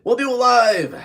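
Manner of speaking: very high, loud yelled last line; shouted punchline. Retold or imitated. Kid shouting Bill O'Reilly's closing line, very high and loud. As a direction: belt the final line out. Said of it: "Bill O'Reilly goes".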